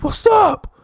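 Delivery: high, loud aggressive taunt; squaring up to a stranger; hillbilly tough guy yelling. Retold or imitated. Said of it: "this guy Cliff goes".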